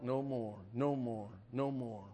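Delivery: low, hushed, dying away; quiet, solemn echoing close; fading repetition. Real, not acted. Sermon point repeated, fading away.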